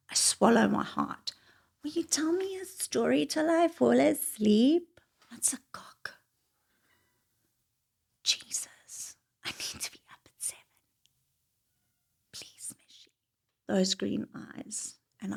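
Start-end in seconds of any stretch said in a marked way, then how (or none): 1.8-5.0 higher pleading voice. Retold or imitated. His request, voiced by teller. 5.3-13.1 hushed unvoiced whisper; muttered private whisper; whispered aside. Real, not acted. Her inner reaction, whispered.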